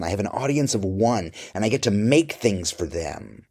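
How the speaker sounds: usual speaking voice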